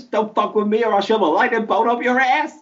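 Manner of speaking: high mock American accent as Zeus; over-the-top American accent playing Zeus. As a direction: bellow it as a hammed-up American Zeus with a sore throat.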